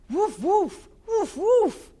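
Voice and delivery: high, loud yappy puppy woofs; excited puppy barks. Acted. Reading a puppy story to kids, high, loud yappy barks. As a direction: bark like an eager little dog.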